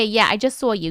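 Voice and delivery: usual speaking voice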